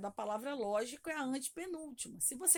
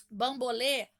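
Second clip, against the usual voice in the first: Bambolê with a loud final lê; stress on last syllable; 8 dB louder, slower than usual. Shown as a demonstration. Teacher showing the class the tonic syllable, Bambolê stressed on lê. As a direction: punch the lê at the end of Bambolê. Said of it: "a sílaba tônica é o lê".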